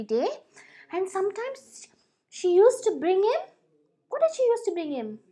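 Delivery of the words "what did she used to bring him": plain reading voice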